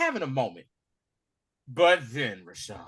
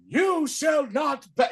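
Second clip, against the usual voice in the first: higher, slow mock shout of Gandalf; Gandalf quote; 10 semitones higher, slower than usual. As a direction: boom it out slowly like Gandalf. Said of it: "the same way when Gandalf had to do".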